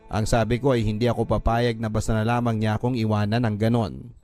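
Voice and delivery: ordinary talk, nothing marked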